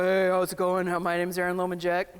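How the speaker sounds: put-on deep, manly introduction; mock dropping the voice too far; exaggerated low voice. Shown as a demonstration. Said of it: "I'm not asking you to go".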